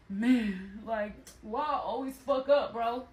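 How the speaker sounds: put-on mopey, self-pitying boyfriend.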